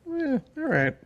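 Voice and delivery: high, soft, shrugging all right; breezy, unbothered; mock nonchalant. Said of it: "she's like".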